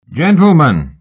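high, loud call down from the stairs; crying out. Acted. Mister Sayers to the gentlemen from the stairs, loud cry. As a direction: call the whole company to attention. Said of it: "Mister Sayers cried from the stairs".

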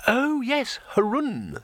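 very high, airy realisation; dreamy recollection. Acted. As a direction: remember it from far away in thought. Dreamer suddenly remembers the hero.

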